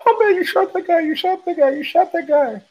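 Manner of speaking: high-pitched panicked retelling, words tumbling in repeats; freaked out. Retold or imitated. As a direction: imitate a man freaking out, voice way up high. Freaked-out getaway driver voiced in story.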